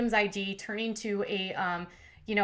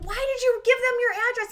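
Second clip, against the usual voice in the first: exasperated daughter, high; 14 semitones higher, 5 dB louder than usual. Retold or imitated. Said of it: "she's like".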